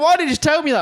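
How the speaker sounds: high, stunned, half-shouted question; incredulous friend. Retold or imitated. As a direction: ask it in disbelief, pitch way up. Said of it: "he was like".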